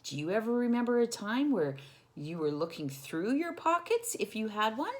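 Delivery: usual speaking voice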